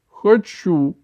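high, loud confession; fervent. A narrator's reading. Grandmother admitting she wants him called granny's boy, fervent. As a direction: admit it with fervour. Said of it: "горячо призналась бабушка".

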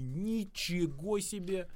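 slow, wide-eyed wow; amazed gasp. Retold or imitated. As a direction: gasp it out in awe. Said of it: "все бы такие". (медленное восхищённое «ничего себе»)

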